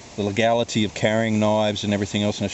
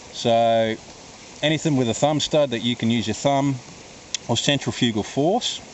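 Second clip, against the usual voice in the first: ordinary talk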